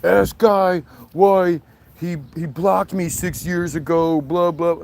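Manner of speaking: mock troll voice. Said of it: "one guy on there talking about".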